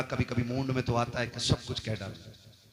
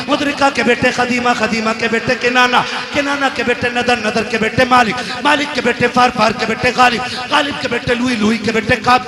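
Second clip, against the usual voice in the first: high, loud unbroken chanted lineage; all in one breath; 9 semitones higher, 15 dB louder than usual. Acted. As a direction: rattle off the genealogy without pausing. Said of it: "मौलवी की सांस फंस रही है". (एक ही साँस में ऊँची आवाज़ में)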